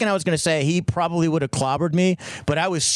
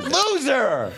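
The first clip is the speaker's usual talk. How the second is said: high, drawn-out playful jeer; mock heckle; 10 semitones higher than usual. Mock heckle at himself after tripping.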